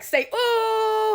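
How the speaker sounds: high, loud eager prompt; urging a child to sing the note; coaxing mother. Retold or imitated. Mother coaching her daughter to sing, high and eager. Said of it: "And she's like".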